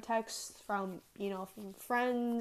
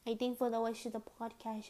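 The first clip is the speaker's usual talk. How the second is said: ordinary talk, nothing marked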